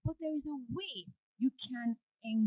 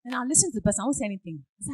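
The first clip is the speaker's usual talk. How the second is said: ordinary talk, nothing marked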